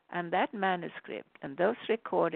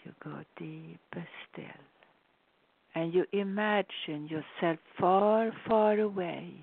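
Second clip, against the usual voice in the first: guided meditation. Acted.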